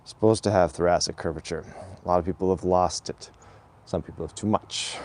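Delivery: ordinary talk, nothing marked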